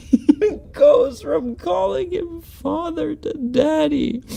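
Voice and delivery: laughing hard. Real, not acted. Laughing hysterically at the clip.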